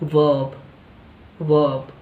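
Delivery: वर्ब said as "vub", no r; वर्ब repeated with an "a" sound, no r. Shown as a demonstration. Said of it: "र sound नहीं आती".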